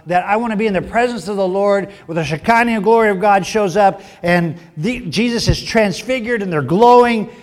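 ordinary talk

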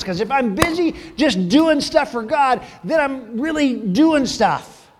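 hammed-up busy zeal; mock eager believer. Churchgoer rushing to do something spiritual, mock eager. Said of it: "and we think".

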